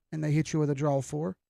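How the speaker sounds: usual speaking voice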